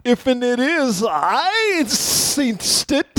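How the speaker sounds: high, drawn-out servile character voice; imitating a meek, over-deferential character; Damon Wayans. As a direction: drag it out high like a comedy bit character. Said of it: "an old Damon Wayans bit".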